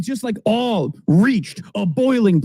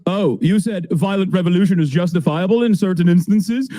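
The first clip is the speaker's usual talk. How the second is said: mock Piers; 5 semitones lower than usual. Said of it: "he immediately goes".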